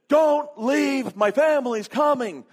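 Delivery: high, loud mock-desperate plea; frantic begging. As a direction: beg them to hold the plane. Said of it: "he's just begging for them to wait".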